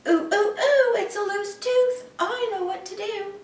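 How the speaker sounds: high, loud fussing mom voice; fussing mother. Acted. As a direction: coo over the tooth like a fussing mother.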